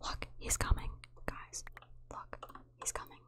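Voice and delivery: hushed, voiceless whisper; sneaky whisper. Pretend. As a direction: whisper so the enemy can't hear. Sneaking from approaching player.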